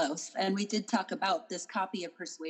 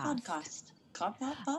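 mock British accent; 5 semitones higher, 3 dB quieter than usual. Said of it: "have you heard anybody with a British accent".

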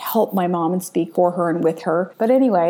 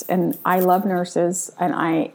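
ordinary delivery

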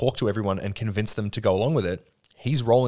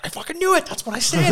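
high, excited I-told-you-so; squeaky gleeful vindication; Ed's gloating; 13 semitones higher than usual. Retold or imitated. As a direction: crow it triumphantly in a much higher voice. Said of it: "And Ed's like".

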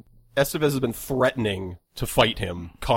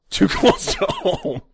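high squeaky mock voice; slower than usual. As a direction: squeak it out, laughing. Hammy repeat of joke.

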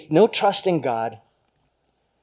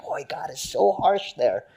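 musing thought; 5 semitones higher than usual. Retold or imitated. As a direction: think it aloud slowly, a little taken aback. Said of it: "I always read that passage and think".

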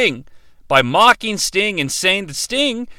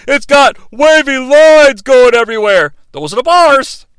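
loud, higher, hammed-up wrestler rant; Hacksaw Jim Duggan; 6 semitones higher, 7 dB louder than usual. Wrestler praising Canada, mocking the flag, loud raised bluster. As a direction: bellow it like a mock anti-flag speech. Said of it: "gives a speech about how awesome Canada is".

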